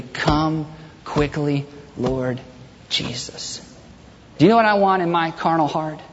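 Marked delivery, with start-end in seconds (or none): 0.0-3.6 very slow, low, soft prayer; hushed sincere prayer. Acted. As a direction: pray it slowly, sinking to near whisper. Models the changed, sincere prayer.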